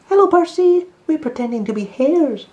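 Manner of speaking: high, loud, bouncy little rabbit voice; young rabbits calling out, full of fun. Acted. Rabbits call out cheerfully.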